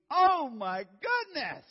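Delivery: high, slow, very loud cry with a laugh; pained cry. Retold or imitated. Father's back taking the impact, loud slow pained cry. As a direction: howl it like your spine is screaming.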